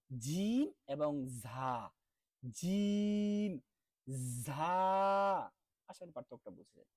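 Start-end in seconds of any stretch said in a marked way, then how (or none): 0.1-5.5 জিম stretched very long and loud, ঝা clear; jim and jha drill. Shown as a demonstration. Teacher drilling জিম versus ঝা aloud. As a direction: alternate জিম and ঝা slowly. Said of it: "দুইটার পার্থক্য".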